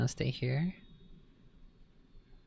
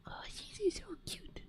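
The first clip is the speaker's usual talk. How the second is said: high cooing; 9 dB quieter than usual. Real, not acted. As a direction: coo at a cute kitten. Gushing over kitten.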